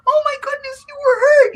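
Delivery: squealed way up high, fussing; high gushing worried women. Acted. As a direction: coo over the hurt man like a worried auntie. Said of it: "start fussing over you".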